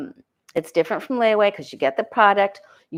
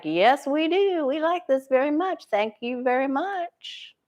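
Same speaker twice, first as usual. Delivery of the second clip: a touch of smug delight, higher and slowed; gleeful public; 6 semitones higher than usual. Retold or imitated. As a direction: sing it out happily like pleased customers. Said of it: "everybody else is like".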